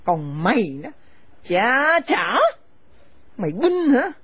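harsh, contemptuous scolding of a daughter-in-law; bitter older woman driving her son's wife from the house; angry scolding mother. Acted. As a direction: spit the insults at her and order her out. Mother turns on her son.

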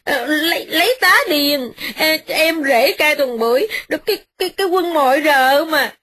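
high, tearful ranting wife; wife ranting endlessly at her husband; ranting young wife. Acted. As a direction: rail on and on, near tears. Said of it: "mợ nói mấy giờ đồng hồ".

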